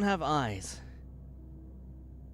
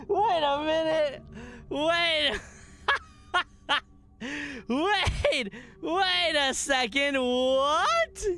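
high, excited cries through laughter; laughing disbelief; 9 semitones higher, slower than usual. Real, not acted. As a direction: exclaim in astonishment while cracking up. Cracking up in disbelief at cameo.

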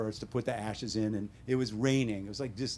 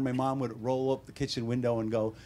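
ordinary talk

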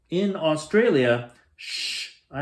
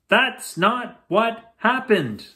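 indignant protest; 6 semitones higher, slower than usual. Real, not acted. Sister disputes brother's version.

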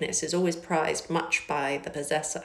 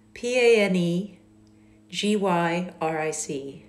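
spelling out letters. Shown as a demonstration. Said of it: "what is this word?".

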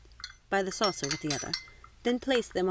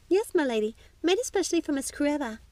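much higher, louder maid's voice; perky young maid; 8 semitones higher, 4 dB louder than usual. A narrator's reading. Maid presenting a treat to her lady, high and perky. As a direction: pipe up as an eager servant.

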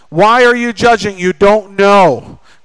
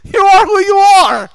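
high, raised-voice retelling of an exasperated outburst; mock exasperated telling-off; upset; 8 semitones higher than usual. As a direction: snap it out high, imitating a fed-up man. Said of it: "Deacon Gary got so upset".

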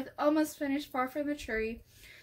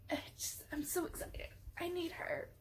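soft gushing; 4 semitones higher, 12 dB quieter than usual. Real, not acted. Overwhelmed fan gushing softly.

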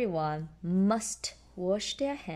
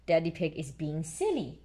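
ordinary delivery, nothing marked

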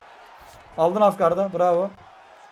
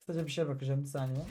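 quiet aside; 4 semitones lower, 11 dB quieter than usual. Real, not acted. Aside while checking menu.

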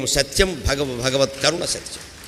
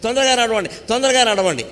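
impatient urging; 6 semitones higher than usual. Acted. Nahusha urging the bearers.

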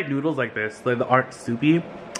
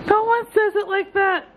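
high, slow, outraged pitch; high protest. Real, not acted. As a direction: squeal it in disbelief.

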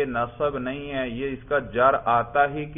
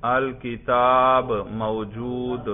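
very slow, drawn-out dictation; 3 dB louder, much slower than usual. Shown as a demonstration. Teacher dictating the next sentence to students, slowly. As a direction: dictate each word slowly for writing. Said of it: "اگلا جملہ لکھیے بھائی".

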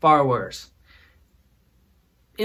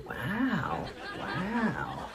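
mock amazement; 4 semitones higher, 6 dB quieter than usual. Mock amazement at audience's spelling.

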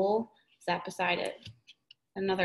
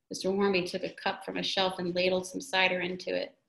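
ordinary delivery

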